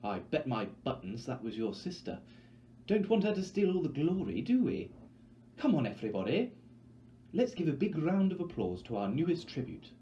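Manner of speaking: a hostess whipping up the crowd; trilling Effie. Acted. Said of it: "trills Effie Trinket".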